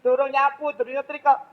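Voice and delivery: omelan tinggi dan keras, berapi-api; ibu mengomel. Retold or imitated. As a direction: cerocos keluhan ibu yang repot. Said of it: "Ada banyak ngomong". (ibu yang repot mengomeli anak, nada tinggi dan keras)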